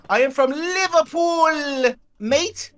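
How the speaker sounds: hammed-up Liverpool accent, mate on top; mock Liverpool accent. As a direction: drag out Liverpool like a bad Scouser. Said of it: "That's not a Liverpudlian accent".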